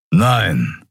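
gruff, falling refusal; blunt Keru. Acted. Keru refuses to trust Endriel, blunt and gruff. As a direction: shut her down with one word.

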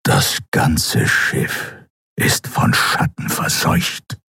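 very slow, low, voiceless growl; growling Skria. A narrator's reading. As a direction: growl it out word by word, ominously. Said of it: "knurrte Keru". (sehr langsames, tiefes, tonloses Knurren)

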